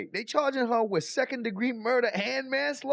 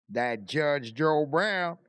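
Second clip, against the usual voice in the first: slow, lower drawn-out "Brown" in a lightly put-on Southern accent; a hint of Judge Joe Brown's drawl, slow and low; mimicking Judge Joe Brown; 7 semitones lower, much slower than usual. Telling friends about a TV judge, lightly imitating his accent slowly. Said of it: "strongest accent I ever seen".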